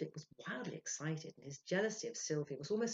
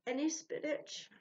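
drowsy; 12 semitones higher, 4 dB louder, slower than usual. A narrator's reading. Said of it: "she said drowsily".

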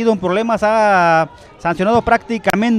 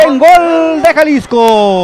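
excited praise; 7 semitones higher, 8 dB louder, much slower than usual. Real, not acted. Praising the goal.

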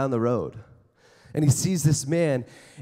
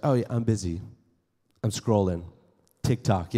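distracted phone user; 8 semitones lower, 3 dB quieter than usual. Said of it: "you're like".

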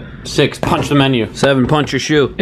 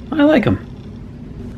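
George Clooney; 4 dB quieter, much slower than usual. Pretend. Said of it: "my impression of George Clooney".

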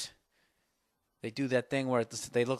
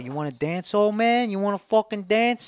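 high, loud mock tough guy from a commercial; tough guy challenge; 9 semitones higher, 9 dB louder than usual. Comic riffing on an old guy, imitating a commercial's loud taunt. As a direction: bark the challenge like a cocky bruiser. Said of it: "Remember that commercial where".

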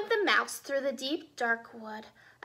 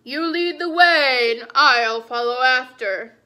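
humouring Gruffalo; 11 dB louder than usual. Acted.

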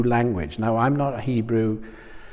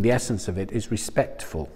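ordinary talk

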